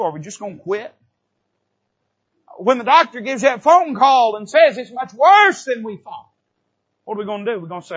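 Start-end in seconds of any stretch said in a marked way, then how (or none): 2.6-7.6 louder preacher's build; preaching swell. Acted. Preacher warning the church about bad news. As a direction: lift the hard news to a climax.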